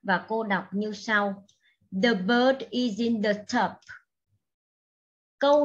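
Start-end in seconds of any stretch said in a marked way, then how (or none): 2.0-3.7 "The bird is in the tub" read as one smooth phrase with the words joined; đọc nối liền cả câu; linked reading. Shown as a demonstration. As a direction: link the words of "The bird is in the tub" together, no gaps. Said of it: "Nếu như đọc nối, cô sẽ nối hai từ này".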